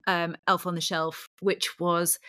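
ordinary talk

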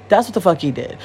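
ordinary talk, nothing marked

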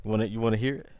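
ordinary delivery

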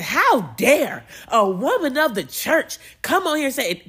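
loud, high scolding with big swoops; mock outrage. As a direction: imitate an indignant critic dressing someone down. Outraged critic quoted from stitched video.

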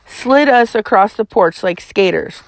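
ordinary delivery

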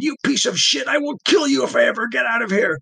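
high, loud furious threat; furious scream. Acted. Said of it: "Nikolai screamed".

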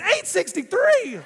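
loud, high outraged disbelief; hammed-up outrage at the bill; indignant shock. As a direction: balk at the price, loud and high. Indignant retort at the price.

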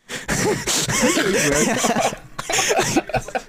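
drawn-out high-pitched laughter; long high laugh. Real, not acted. Laughs at own story.

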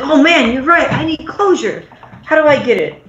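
high-pitched, hammed-up sudden realisation; mock epiphany. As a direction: imitate an over-eager friend seizing on advice. Drunk Rachel's sudden realization, retold.

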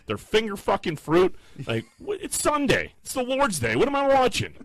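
high, climbing incredulous rant; outraged disbelief. Real, not acted. Telling friends about the ad, in high outraged disbelief. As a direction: sell how absurd it is.

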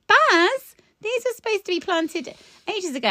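high-pitched mock telling-off; retold exasperated scolding. Visitor chiding grower over unplanted tulips, exasperated. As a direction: exclaim the name, then chide.

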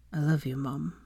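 soft, weak-voiced affection; quiet, sincere murmur; tender. A narrator's reading. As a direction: tell mother gently from a sickbed. Weak son reassuring worried mother.

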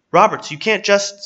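high, loud objection cut short; urgent protest. Acted. Chief protesting as she knocks on car, urgent protest. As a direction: blurt it out to stop her.

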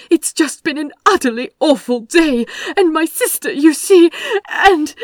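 high, loud, tumbling apology; flustered rush of words; breathless babbling. A narrator's reading. Aziraphale to the other driver, frantic breathless apology. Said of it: "The flood of words died, leaving him breathless".

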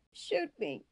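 high, strained plea of a dying man; desperate cry. A narrator's reading. Dying prisoner begs the guards, crying out. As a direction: cry out for a quick end. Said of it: "the man cried".

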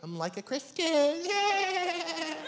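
high, soft girlish mimicry; mock her timid half-commitment, ending in a squeal; silly put-on woman's voice; mock lukewarm believer.

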